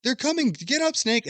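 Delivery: high desperate shout, urging someone up; frantic yelling. Retold or imitated. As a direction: yell it in panic as enemies close in. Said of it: "He's like".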